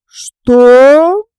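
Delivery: loud, drawn-out indignant question, sharply rising; one long, loud, climbing cry of affront; angry outburst. A narrator's reading. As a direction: explode in outraged disbelief. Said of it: "не ожидавшая такого гнева".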